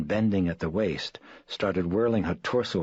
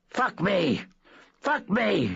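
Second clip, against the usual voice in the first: much higher obscene scream; 4 dB louder, slower than usual. Acted. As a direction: shriek it at the doctors. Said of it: "she screamed at the doctors".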